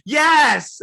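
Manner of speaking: high, loud emphatic yes; mock shout. Comic girlfriend shouting yes in a mock shout. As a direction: shout it in outrage. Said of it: "She's like".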